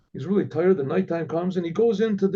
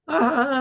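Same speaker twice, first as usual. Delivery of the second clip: higher imitated guffaw; mock drunken laughter; 7 semitones higher than usual. Ruffians laugh at the joke.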